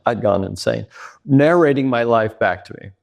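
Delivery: ordinary delivery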